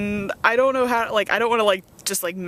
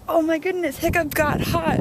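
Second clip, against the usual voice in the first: high, swooning fangirl voice; mock fangirl gush; 9 semitones higher than usual. Mocking fans gushing over older Hiccup, high and swooning. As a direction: gush like a smitten fan.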